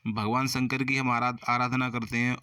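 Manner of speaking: ordinary talk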